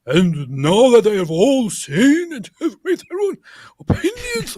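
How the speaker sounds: high, loud pompous preacher voice; mocking grand proclamation. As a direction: ham up a triumphant debate closer. Mocking debater's grand finish.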